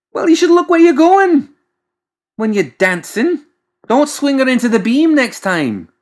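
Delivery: very high, loud indignant scolding. Acted. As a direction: blame the servant shrilly. McMorning scolding.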